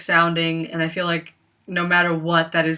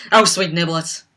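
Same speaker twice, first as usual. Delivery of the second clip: lightly voiced Southern accent; a hint of her Southern twang; 3 dB louder than usual. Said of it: "It was always her accent".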